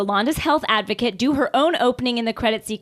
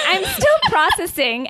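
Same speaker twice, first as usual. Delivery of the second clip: laughing; 9 semitones higher than usual. Real, not acted. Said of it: "reading with laughter".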